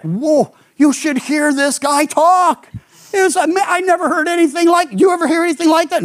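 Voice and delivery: high-pitched, amazed gushing; blown away. Acted. Officers reporting back without the prisoner, blown away. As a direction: act out awestruck officers, voice jumping up and speeding up. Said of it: "They're just so blown away".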